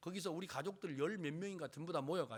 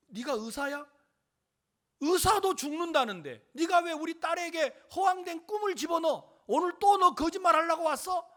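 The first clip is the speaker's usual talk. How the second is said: loud, high-pitched tirade; angry scolding; 11 semitones higher, 11 dB louder than usual. Retold or imitated. Girl's parent berates him for giving false hope, angry scolding. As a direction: re-enact a furious parent telling him off. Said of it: "막 욕을 하면서".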